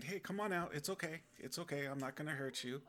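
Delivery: a kind savior calling someone out of hiding; soft inviting call; gentle Jesus. Retold or imitated. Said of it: "Jesus there at the door saying".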